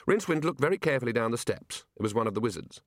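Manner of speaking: usual speaking voice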